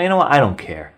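normal talking voice

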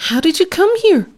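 high, fast startled question; young pig startled by a stranger, asking high and fast. A narrator's reading. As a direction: blurt it out in surprise. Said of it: "asked the Pigling Bland".

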